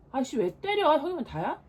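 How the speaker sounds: loud outraged yelp; angry protest. Acted. Little brother yelling at big brother after being hit, loud and angry. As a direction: yell back at the brother who hit you.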